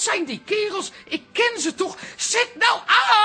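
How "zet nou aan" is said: shouted, higher and louder, slowed. Acted. Yelling at Toos to turn the TV sound up, loud shout. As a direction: bark the order at her to turn it up. Impatient at silent TV news.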